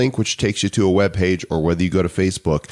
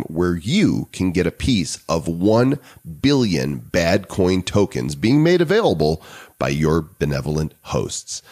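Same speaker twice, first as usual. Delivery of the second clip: ordinary talk, nothing marked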